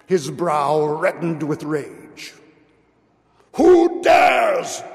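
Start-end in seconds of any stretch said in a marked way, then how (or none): 3.6-4.8 high, loud furious cry; hoarse demand. Acted. Prince shouting at his courtiers, enraged shout. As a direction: roar it at the courtiers. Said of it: "he demanded hoarsely".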